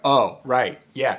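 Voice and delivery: loud, sudden aha; excited realization. Retold or imitated. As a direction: burst out with recognition.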